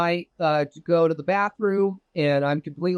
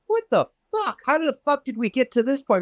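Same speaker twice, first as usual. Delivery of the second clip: much higher outburst of disbelief; 8 semitones higher than usual. Retold or imitated. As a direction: blurt it out, pitched way up in shock. Retelling his own shocked reaction.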